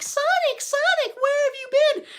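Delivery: very high, annoying falsetto; Amy. Acted. Streamer mocking her pestering Sonic, annoying high Amy voice. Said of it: "Amy's so annoying all the time".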